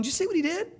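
high-pitched mock accusation; imitated high, incredulous telling-on; mock tattling accuser. Imitates Satan tattling to God.

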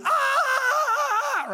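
long, high, loud scream; drawn-out comic shriek of falling; mock falling scream. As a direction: pretend you're plummeting off a cliff, screaming all the way down. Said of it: "also learn loud noises".

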